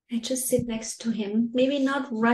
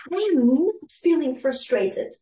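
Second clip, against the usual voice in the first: put-on higher, louder protesting child; upset child; 5 semitones higher, 6 dB louder than usual. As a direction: protest like a cross little kid. Mother voicing an upset child.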